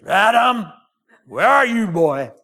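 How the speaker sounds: loud, high, scolding call; stern angry shout of Adam's name; angry God. Shown as a demonstration. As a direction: bark it like a mad preacher. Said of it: "he'd sound like this".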